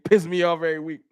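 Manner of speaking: ordinary talk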